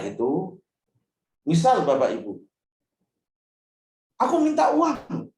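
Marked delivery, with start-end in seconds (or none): 4.2-5.3 put-on much higher, louder demanding child; whiny child shouting. Child yelling at parent for money, mock whiny demand. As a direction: demand it like a spoiled kid. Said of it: "teriak-teriak".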